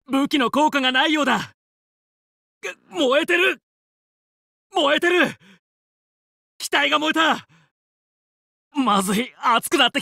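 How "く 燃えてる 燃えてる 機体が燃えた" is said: higher, tense cry that the ship is burning; alarmed. Acted. Pilot alarmed his ship is burning, higher tense cry. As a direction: panic as the flames catch.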